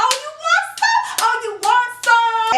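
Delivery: high, sustained taunting yell; fighting challenge. Retold or imitated. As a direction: re-enact a furious challenge, voice way up. Acting out challenge during the fight.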